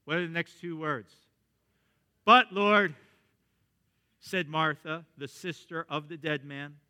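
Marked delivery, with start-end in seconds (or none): 2.2-2.9 loud protest. Acted. Martha objects to opening the tomb.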